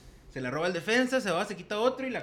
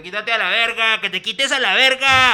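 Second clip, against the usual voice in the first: put-on louder and higher, an angry teenager screaming; yelling; 5 semitones higher, 10 dB louder than usual. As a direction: shout it like a bratty kid losing it. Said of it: "el chavito acá que gritó".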